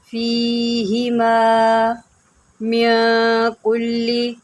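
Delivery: slow, drawn-out tajweed chanting; measured melodic Quranic recitation. Shown as a demonstration. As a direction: recite slowly, stretching each vowel. Recitation continues.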